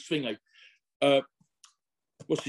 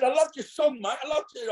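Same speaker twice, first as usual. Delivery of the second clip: higher, eager fan praise; gushing fan; 9 semitones higher than usual. Retold or imitated. Telling the singer he loved it, higher eager gushing. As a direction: gush like a starstruck fan.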